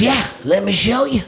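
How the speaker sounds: a chipper guy offering help; stranger's voice. Retold or imitated. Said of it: "He says".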